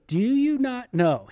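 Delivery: high, slow, pointed rhetorical question; emphatic quote. Acted. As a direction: press the question home slowly. Quoting Paul's rhetorical question.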